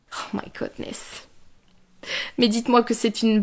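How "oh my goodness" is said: breathless stunned whisper. Real, not acted. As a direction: whisper it in disbelief. Stunned aside to the audience.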